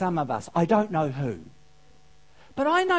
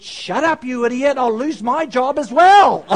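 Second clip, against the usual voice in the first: angry lion voice; 4 semitones higher, 11 dB louder than usual. Acted. Lion-suited man snaps back.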